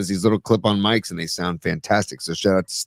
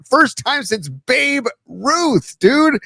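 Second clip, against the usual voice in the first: excited; 13 semitones higher, much slower than usual. Real, not acted. Excited about a historic record.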